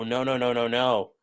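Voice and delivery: ordinary talk, nothing marked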